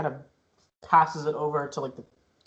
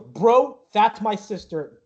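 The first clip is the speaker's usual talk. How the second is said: higher, slowing protest; annoyed brother; 6 semitones higher, 3 dB louder, much slower than usual. Acted. Eric corrects the ticket seller about his sister, annoyed. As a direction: set him straight, slightly raised voice.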